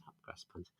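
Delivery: breathy whispered aside of the pun. Shown as a demonstration. Host whispering the pun again to the chat, hushed.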